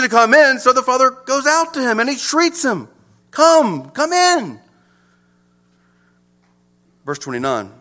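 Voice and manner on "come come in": inviting. Retold or imitated. Father invites older son inside.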